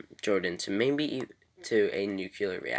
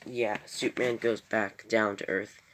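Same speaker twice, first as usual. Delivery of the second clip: ordinary talk, nothing marked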